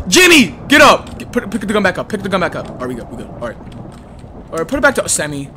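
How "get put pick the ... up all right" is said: usual speaking voice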